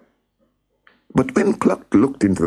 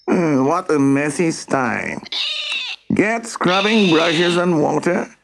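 high, strained scolding character voice; bossy farmer; 11 semitones higher than usual. A narrator's reading. Mister Moan scolds the pigs over their messy sty, bossy. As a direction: order them about in a high, imperious voice.

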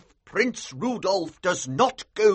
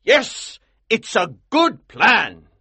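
loud, high, emphatic approval; exultant colonel, punching each word; excited cry; 13 semitones higher, 9 dB louder, slower than usual. A narrator's reading. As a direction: cry out, thrilled with the plan. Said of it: "he cried".

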